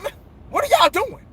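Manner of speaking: put-on dad catching kids at night; parent scolding kids. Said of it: "I said".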